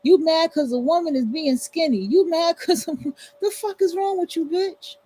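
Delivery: high, mocking scold with a laugh; high-pitched sassy scolding; sassy callout. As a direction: tell her off in a high, exasperated voice. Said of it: "We're like".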